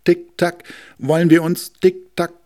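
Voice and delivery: high, loud ticking voice; ticking clock. Acted. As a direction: tick it out like a wall clock. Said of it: "sagte die Wanduhr".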